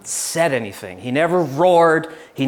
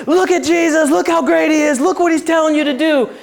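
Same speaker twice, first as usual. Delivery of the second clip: much higher and louder, pushy preacher; preachy loud pushing; 11 semitones higher, 4 dB louder than usual. Retold or imitated. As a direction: hammer Jesus at them, overbearing. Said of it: "People walk in and I try to say".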